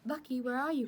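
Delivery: high, anxious call through the house; crying out. Acted. Rose calls for Lucky running downstairs, high worried cry. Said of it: "cried Rose".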